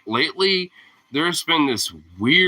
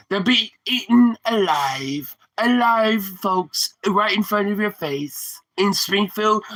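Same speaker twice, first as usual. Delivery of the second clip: higher, slow, hammed-up rally speaker; Trump impression; 5 semitones higher, slower than usual. Mocking the debate claim about Springfield, hammed-up politician voice. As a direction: ham up a blustery debate-stage politician.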